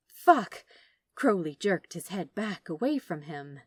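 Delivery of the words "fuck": high, loud startled yelp; sudden curse. Acted. Crowley panics at the memory of their noses touching, yelping. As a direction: blurt it out in alarm.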